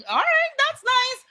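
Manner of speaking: high, lilting approval; pleased guest. Retold or imitated. Guest taking in the bigger room, high and pleased. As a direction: sing it out, pleasantly impressed. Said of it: "I'm over here like".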